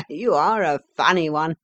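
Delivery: amused inspector with a little laugh; chuckling. A narrator's reading. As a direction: chuckle through it. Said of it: "chuckled the inspector".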